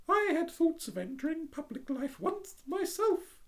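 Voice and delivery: prim little duck voice, high. Acted.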